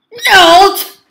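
very loud, high, stretched note; shouted jump command. Real, not acted. Player making the game character jump, shouting high. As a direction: belt one long high syllable.

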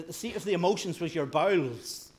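normal talking voice

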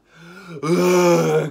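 loud, high hammed-up sob; big fake crying outburst; mock bawling wail. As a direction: burst into exaggerated waterworks. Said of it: "Cue the waterworks".

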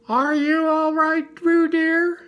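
high, worried motherly call; anxious calling. A narrator's reading. Kanga checks on Roo in the pool, anxiously calling. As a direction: call out high and slow across the water. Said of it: "called Kang anxiously".

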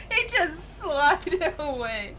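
slow words broken by laughter; laughing. Real, not acted. Streamer laughing at a player sliding away, slowly. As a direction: laugh through the whole line.

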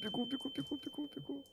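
very high squeaky panicked chant; panicked squeak. Real, not acted. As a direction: squeak it out while fleeing. Fleeing monster in panic.

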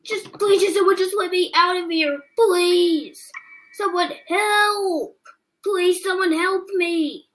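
high, drawn-out cries for help; desperate pleading. Acted. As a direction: beg to be let out. Locked in, calling for help.

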